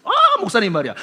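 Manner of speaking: ordinary talk, nothing marked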